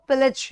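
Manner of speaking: पिलज with a short "i" in the second syllable, "PIL-ij"; correct pronunciation. Shown as a demonstration. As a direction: say पिलज like "pillij". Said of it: "इसका जो pronunciation है".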